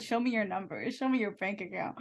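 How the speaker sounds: quiet, lightly voiced demanding official; mock border guard. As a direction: murmur it like a greedy gatekeeper. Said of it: "They said".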